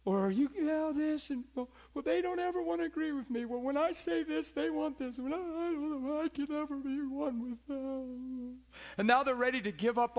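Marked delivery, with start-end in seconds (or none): 0.0-8.6 mimicking a whining spouse, pitched higher; high, plaintive imitation of a complaining church member; a lightly whiny complaining voice; whiny complainer.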